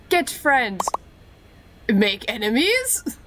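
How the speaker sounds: ordinary talk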